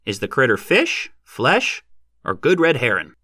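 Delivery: put-on high, dry old heckler's question; tinder-dry, pushy horse trader; insistent. A narrator's reading. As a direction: needle them item by item, pitch jumping up. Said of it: "insisted Hannum".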